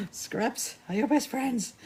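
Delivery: words laughed out slowly; laughing. Real, not acted. Host teasing viewer about scraps, laughing. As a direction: giggle through the advice.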